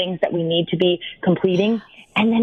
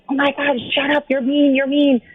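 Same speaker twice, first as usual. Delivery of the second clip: mock-angry higher scolding; berating; 7 semitones higher than usual. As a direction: snap back at the voice, half in jest. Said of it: "try to berate the drill sergeant".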